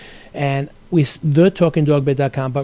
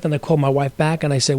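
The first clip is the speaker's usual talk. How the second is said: ordinary talk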